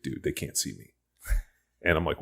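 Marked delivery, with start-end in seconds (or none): 0.0-0.9 low, hushed aside; furtive warning, retold. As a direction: drop it down like someone avoiding notice. Bob warns friend on spotting fans.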